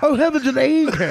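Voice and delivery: put-on loud, high-pitched old-time comic exclamation; Jim Backus. Actor mocking his own slipping accent, comic character-actor imitation. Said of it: "I'm talking like Jim Backus".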